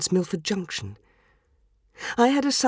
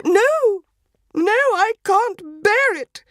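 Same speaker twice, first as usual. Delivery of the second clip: very high and loud, slow, near hysterical; anguished cry; 13 semitones higher, 6 dB louder, much slower than usual. Acted. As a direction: cry out, hands over your ears. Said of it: "I cried".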